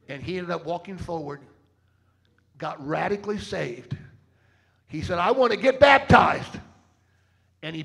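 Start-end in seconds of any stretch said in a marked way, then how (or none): 5.2-6.6 loud, eager big-man shout; loud declaration. Retold or imitated. Preacher voicing the rough dad, loud eager declaration. Mean dad voiced in story.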